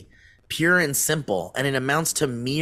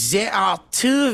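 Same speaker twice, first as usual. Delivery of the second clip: lightly put-on French accent, higher; a hint of a mock French voice; 8 semitones higher than usual. Reading the news for friends in a mock French accent. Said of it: "Can you do it in a French accent?".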